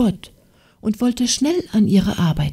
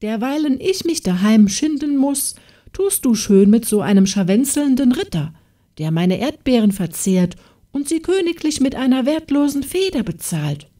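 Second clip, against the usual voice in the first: higher, scornful scolding; jealous farmer's wife berating the maid; scolding tirade; 6 semitones higher than usual. A narrator's reading. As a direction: tear into the girl with contempt. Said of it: "schalt sie".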